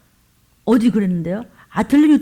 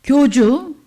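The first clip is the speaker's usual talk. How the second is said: 교주? spat out louder with scorn; mock the title with disdain; scornful echo; 2 semitones higher, 4 dB louder, much slower than usual. Real, not acted. Mocking the cult leader's title. (비꼬듯 크게 되묻는 교주?)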